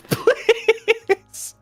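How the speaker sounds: ordinary talk, nothing marked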